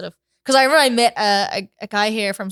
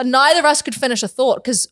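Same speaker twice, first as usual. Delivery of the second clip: ordinary talk, nothing marked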